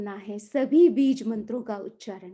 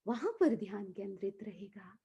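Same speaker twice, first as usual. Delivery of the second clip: whisper; 5 semitones lower, 12 dB quieter, faster than usual. Real, not acted. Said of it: "whispered line in instruction".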